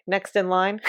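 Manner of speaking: desk clerk voice. Acted. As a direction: dismiss them like a bored clerk.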